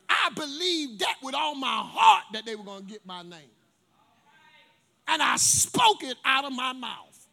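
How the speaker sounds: slow, loud testifying; slow emphatic preaching. Acted. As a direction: drive each word home.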